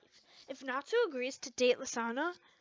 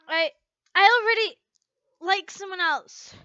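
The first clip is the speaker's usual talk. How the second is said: emphatic admission; 4 semitones higher, 8 dB louder than usual. Acted. Natsu's tense admission.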